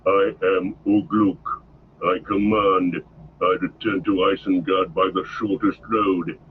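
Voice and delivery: lightly voiced gruff orc chief; low and unbending, stating rank; Ugluk growling. A narrator's reading. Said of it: "said the growling voice".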